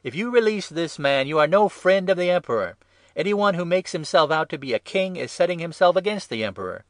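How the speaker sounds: loud, high, accusing shout; crowd shouting. A narrator's reading. Crowd warning Pilate about the emperor, shouting.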